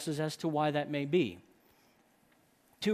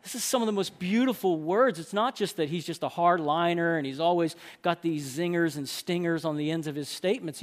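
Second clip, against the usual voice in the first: ordinary talk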